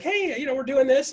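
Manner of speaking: high-pitched caller voice; caller's chirpy pitch. Retold or imitated. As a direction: imitate her breezy pitch up high. Voicing Wendy's pitch again.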